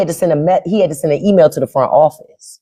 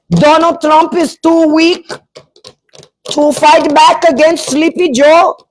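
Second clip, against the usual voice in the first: high, slow mock Indian accent; 10 semitones higher than usual. Troll reading his handbook to the streamer, mock Indian accent. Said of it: "that was my Indian accent".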